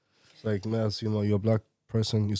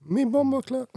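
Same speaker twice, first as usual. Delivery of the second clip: high-pitched jokey patois outburst; playful Jamaican accent, pitched way up; mock Jamaican patois. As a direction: throw out a hammed-up Jamaican phrase. Mimicking Jamaican patois in jest.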